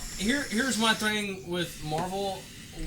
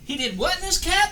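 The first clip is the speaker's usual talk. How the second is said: high mock quote; 11 semitones higher, 5 dB louder than usual. Quoting a movie line, high.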